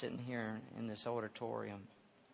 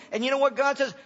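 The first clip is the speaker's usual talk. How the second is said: loud, high preacher's cry; full-throated sermon climax; fiery preaching; 13 dB louder than usual. Acted. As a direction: preach it out full force to the crowd.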